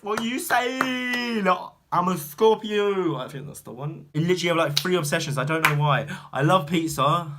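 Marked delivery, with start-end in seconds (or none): 0.0-3.2 high, stretched-out holler; playful boast. Pretend. Bragging about being a Scorpio, playful high holler. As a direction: draw it out high and proud.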